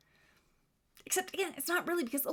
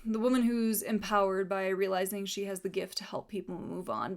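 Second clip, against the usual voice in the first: usual speaking voice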